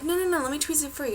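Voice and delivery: quick, high-pitched salon-lady protest; imitated insisting. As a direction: rush in and insist on helping, as the lady.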